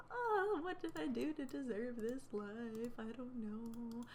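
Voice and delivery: drawn-out, mock-despairing moan; woeful lament. Mother left outside the locked door, theatrical lament. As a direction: wail softly in self-pity, trailing off.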